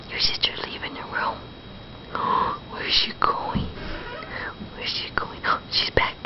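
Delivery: soft whispered wonder. Real, not acted. Whispering to baby.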